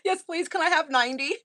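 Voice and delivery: high, hammed-up request, laughing at herself; mock eager pleading. Patient jokingly begs doctor for ninety pills, high mock pleading. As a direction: pitch it up like a cheeky, over-keen patient.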